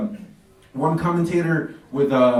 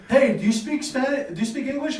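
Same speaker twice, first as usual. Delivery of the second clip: tourist asking; 10 semitones higher than usual. Retold or imitated.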